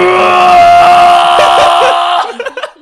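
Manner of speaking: big shouted laugh; loud laughing shout. Real, not acted. Liquids ejected, big laugh.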